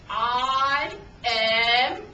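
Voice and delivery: loud, drawn-out declaration; planned shout. Acted. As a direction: call it out long, like a heroic take-off. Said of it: "I'm going to shout".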